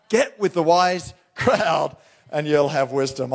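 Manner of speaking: ordinary talk, nothing marked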